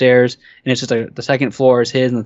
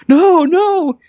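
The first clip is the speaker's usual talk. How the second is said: put-on high-pitched, louder panicked kid; mock panicked denial; 5 dB louder than usual. Kid answering the policeman about the knife, imitated panic. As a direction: imitate a kid pleading innocence.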